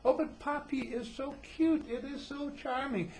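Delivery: high, slow, drawn-out cooing; mock cutesy gushing. Adults finding a baby word cute, mock high cooing. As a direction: gush over it in a sugary high voice. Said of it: "baby talk like that".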